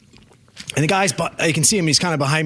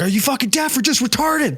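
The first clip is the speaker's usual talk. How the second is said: retold angry screaming; 9 semitones higher than usual. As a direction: imitate an irate driver screaming. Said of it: "screaming at me".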